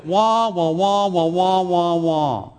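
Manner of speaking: muffled wah-wah like a trumpet with a mute; Miss Othmar muffled horn voice. Retold or imitated. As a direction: hum it like a muted horn, no words. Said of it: "God speaks like this muffled, incomprehensible, horn-like voice".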